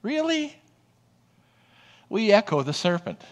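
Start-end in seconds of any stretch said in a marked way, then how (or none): 0.0-0.6 really jumping much higher in doubt; mock disbelief. Preacher mimicking us doubting God, mock incredulous. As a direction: scoff as if you never heard it. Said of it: "We say".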